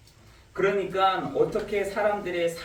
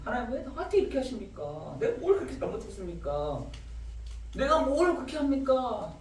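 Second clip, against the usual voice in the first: demanding protest; 7 semitones higher than usual. Retold or imitated. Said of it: "막 그런 질문들을 막 합니다".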